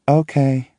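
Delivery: flat, lifeless monotone. A narrator's reading. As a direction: say it with no emotion at all. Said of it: "she says in a monotone voice".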